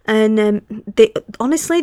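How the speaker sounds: usual speaking voice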